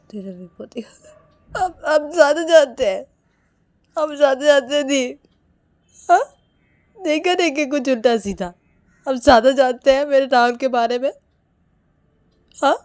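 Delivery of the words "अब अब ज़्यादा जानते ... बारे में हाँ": higher, crying voice pleading; crying and begging the sister; tearful plea. Acted. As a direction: plead through tears.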